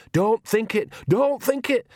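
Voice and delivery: high, urgent repeated warning. A narrator's reading. Nanny warns Magrat off a dangerous thought, urgently. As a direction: urge it fast and high-pitched. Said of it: "said Nanny urgently".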